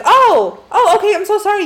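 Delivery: high, loud imitation of a startled apology; mock a flustered "my mistake"; surprised apology. Imitating the guy shown proof of the car shed, surprised and sorry. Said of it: "So he goes, like".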